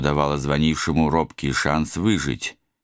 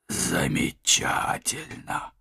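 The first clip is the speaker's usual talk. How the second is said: squeezed out, breathless and choked; strangled; 3 semitones higher, 5 dB quieter, much slower than usual. Acted. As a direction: force it out under a weight. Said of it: "придушенно выдавил я". (придушенно, с трудом выдавливая)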